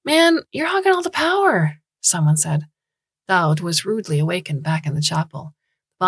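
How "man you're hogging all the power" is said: high-pitched rough complaint; high gruff interruption. Acted. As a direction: blurt it out loud and high. Interrupting voice in chapel.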